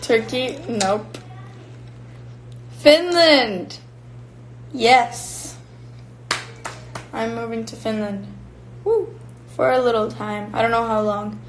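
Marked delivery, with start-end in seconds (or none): 2.8-5.6 excited reveal. Real, not acted. 8.8-9.1 high cheer. Real, not acted. Cheering after the reveal.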